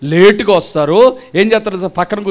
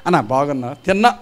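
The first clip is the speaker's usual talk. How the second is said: mock casual greeting; 7 semitones lower, 4 dB quieter than usual. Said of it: "పక్కోడిని కూడా".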